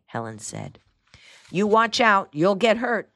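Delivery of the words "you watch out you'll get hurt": higher, louder warning; sharp warning. A narrator's reading. Aunt warns her niece away from the cat, sharp warning. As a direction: warn her off loudly and firmly. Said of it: "Aunt Matilda warned".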